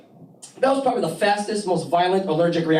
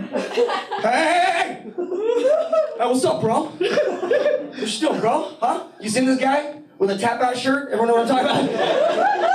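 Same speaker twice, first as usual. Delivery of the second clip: tough club guy; 9 semitones higher than usual. Retold or imitated. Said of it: "He sees me".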